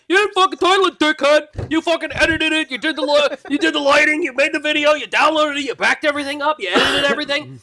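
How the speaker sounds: high, mocking nagging voice; mocking co-hosts' whiny voice. Mimicking co-hosts nagging him about the title, high mock whine. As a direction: whine out the list of his chores in a mock voice. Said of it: "They'll be like".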